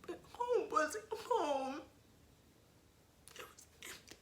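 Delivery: real tears breaking through. Real, not acted. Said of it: "I should have had tissues".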